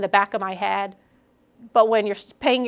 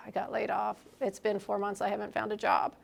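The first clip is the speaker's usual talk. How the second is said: soft, defeated report of bad news; glum sigh; 11 dB quieter than usual. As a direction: mutter it like you've given up. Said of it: "talked like that instead of".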